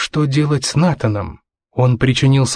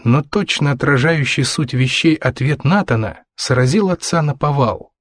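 plain reading voice

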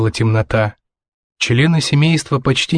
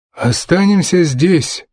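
slow, higher-pitched undead father; lightly voiced father, words drawn out; father's voice; 5 semitones higher, slower than usual. A narrator's reading. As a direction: answer slowly, with effort.

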